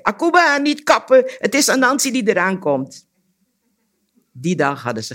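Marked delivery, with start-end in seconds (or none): 0.0-3.1 very high, loud warning cry; panicked calling out. A narrator's reading. Anansi flying through the air warns Akuba, frantic yell. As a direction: yell a frantic plea from far off. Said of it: "riep hij".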